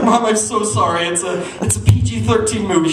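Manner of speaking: high, pleading mock apology; hammed-up guilty child owning up; tearful confession. Son handing mom the tape, tearful confession. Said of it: "I started crying again".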